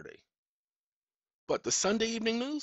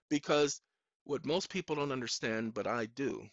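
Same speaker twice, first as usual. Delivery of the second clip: ordinary talk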